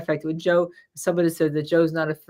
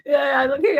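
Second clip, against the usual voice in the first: laughing; 8 semitones higher, 5 dB louder than usual. Real, not acted. Retelling rattlesnake scare.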